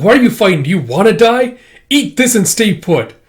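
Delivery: high, loud exasperated telling-off; scolding nag. Acted. Healer to patient, high loud exasperated scolding.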